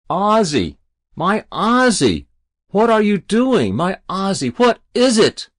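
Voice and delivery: high, loud worried calling; anxious mother calling. Acted. Mother calling up to her son on the roof, high anxious calling. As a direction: call up to your boy, frightened.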